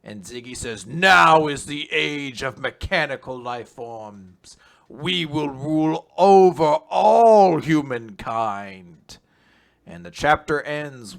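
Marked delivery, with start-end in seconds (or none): 0.9-9.2 slow, grand mock villain declaration; slow, lightly hammed-up villain; slow villain proclamation. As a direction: intone it like a robot overlord.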